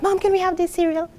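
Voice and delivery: put-on high, eager kid asking; child's voice. Child to mom in the supermarket, high pleading. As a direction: play the little kid begging.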